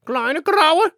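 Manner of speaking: very high, loud bird voice; dove calling. Acted. Dove calls the little grey sparrows, high loud voice. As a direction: call out like a proud dove. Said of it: "rief sie".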